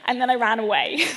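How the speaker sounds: ordinary talk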